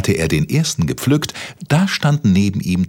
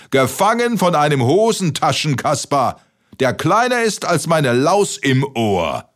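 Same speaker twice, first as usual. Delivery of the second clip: giant voice; 9 semitones higher than usual. Acted. Giant mocking while laughing.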